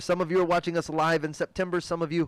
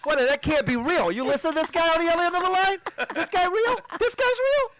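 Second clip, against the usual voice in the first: a hammed-up furious boss, pitched way up; incredulous boss; 14 semitones higher than usual. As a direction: play the boss who can't believe his ears. Acting the disbelieving boss.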